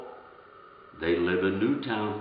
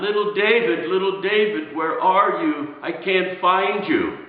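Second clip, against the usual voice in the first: raised, louder mother's shout, higher throughout; calling out frantically; 7 semitones higher, 5 dB louder than usual. Retold or imitated. Frightened mother calling for her lost son, shouting higher. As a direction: call out for a missing child. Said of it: "we could hear her shouting, yelling out".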